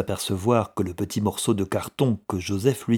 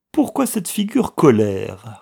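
louder, higher repeated question; indignant echo; 4 semitones higher, 6 dB louder than usual. A narrator's reading. Younger brother repeating the clerk's question, indignant. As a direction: throw the question back indignantly. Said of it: "répéta le cadet".

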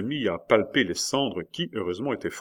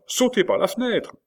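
very high, loud cry; frantic urging; 14 semitones higher, 5 dB louder than usual. A narrator's reading. Nurse telling her master to escape, frantic cry. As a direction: push him to jump right away.

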